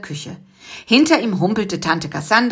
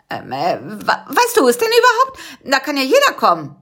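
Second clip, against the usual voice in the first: bluffing trick; 7 semitones higher than usual. Acted. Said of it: "versuchte es mit einem Trick".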